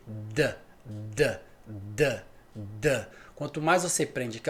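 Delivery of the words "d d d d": D said four times, low and soft; repeated D sounds. Shown as a demonstration. Teacher drilling the D sound, soft repeated taps. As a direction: tap out D slowly for the class. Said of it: "Agora o D".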